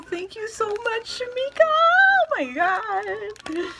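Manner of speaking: high squealed thanks; excited squeal. Real, not acted. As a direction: squeal your thanks to the giver.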